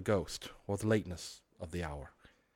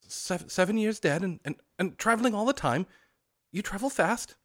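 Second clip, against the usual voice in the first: put-on nervous old man; 10 semitones higher, 4 dB louder than usual. Scrooge questions the ghost.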